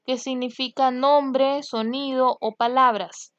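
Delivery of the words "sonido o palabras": normal talking voice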